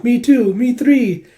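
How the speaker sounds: high, loud fangirl chorus; imitated eager admirers; clamouring admirers. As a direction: pipe up eagerly as a rival fan. Admirers clamouring to serve general.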